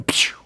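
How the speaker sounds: short airy firing noise; imitated spear-gun shot. Storyteller showing the spear gun firing, whooshed noise.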